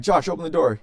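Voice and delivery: loud imitated order; urgent call-out. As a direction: call sharply to a brother to hurry. Retold urgent order to brother.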